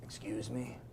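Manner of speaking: beholder's hushed, breathy rumble; a looming monster speaking low and soft; deep rumbling voice. Acted. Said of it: "a kind of a deep rumbling voice".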